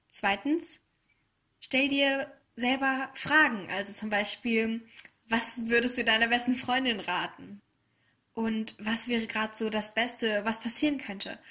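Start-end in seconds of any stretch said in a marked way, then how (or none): none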